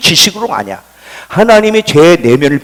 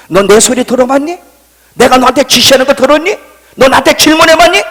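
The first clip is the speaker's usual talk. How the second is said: high, insistent questioning; reenacted divine interrogation; God challenging; 8 semitones higher, 5 dB louder than usual. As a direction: fire mounting questions, climbing louder and higher. Acts God challenging the pastor.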